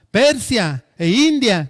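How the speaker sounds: loud, high and slow; boastful shout. Acted. Alexander naming his next conquests to Diógenes, loud shout. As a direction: declare each land like a trophy.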